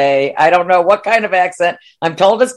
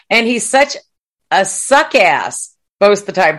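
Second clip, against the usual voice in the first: ordinary delivery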